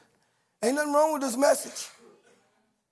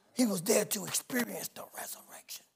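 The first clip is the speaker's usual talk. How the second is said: whispered, much softer; awed whisper; 4 semitones lower, 10 dB quieter than usual. Real, not acted. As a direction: drop to a hush in awe. Hushed awe after Lazarus quote.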